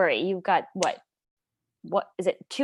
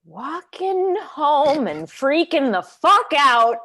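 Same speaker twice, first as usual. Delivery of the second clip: drawn-out louder caption read; slow dramatic caption; 7 dB louder, much slower than usual. Acted. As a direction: announce it slowly. Narrated closing caption.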